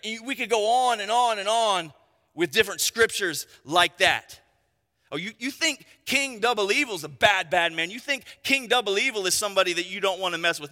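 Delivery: ordinary talk, nothing marked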